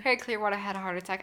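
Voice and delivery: rattled off quickly; fast. Shown as a demonstration. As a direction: rush it like a tongue twister. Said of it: "say it five times fast".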